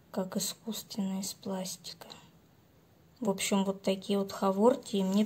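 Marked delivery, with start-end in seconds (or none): 0.1-2.1 soft admiring whisper. Real, not acted. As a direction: breathe it out in a whisper. Admiring plant, hushed aside. (шёпотом)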